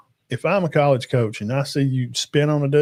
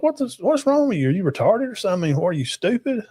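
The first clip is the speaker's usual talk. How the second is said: imitating his mom's scolding, higher pitch; mock outraged mother, pitched up; 7 semitones higher than usual. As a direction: play the mother scolding her son in a raised, higher voice. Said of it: "She's like".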